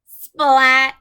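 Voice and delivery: splat hit hard and loud. A narrator's reading. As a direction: make the pumpkin crash burst out. Pumpkin smashes.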